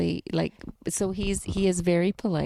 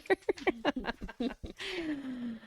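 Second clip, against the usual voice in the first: soft laugh; 8 semitones higher, 8 dB quieter than usual. Real, not acted. Laughs while turning page.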